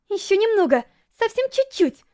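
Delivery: high, urgent self-encouragement; will yourself closer, pitch climbing; straining urgency. A narrator's reading. Racer reaching for her falling rival, urgent.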